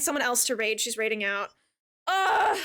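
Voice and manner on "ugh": loud frustrated groan; dramatic sigh. Real, not acted. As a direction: groan out loud in mock despair.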